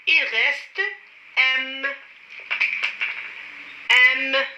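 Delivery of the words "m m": "M" with a long, held hum at the end; teacher modelling "M" clearly, stretched out; sounded-out letter. Shown as a demonstration. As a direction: hold the m sound of "M". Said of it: "Répétez après moi".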